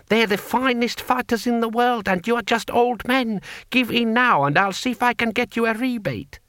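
high-pitched, anxious official pleading; alarmed taxman. A narrator's reading. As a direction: urge surrender in a high character voice. Alarmed taxman pleads with old men.

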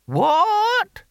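very high, piercing query; deaf old man. Acted. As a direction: squawk it, unable to hear. Deaf old Hamish cannot hear.

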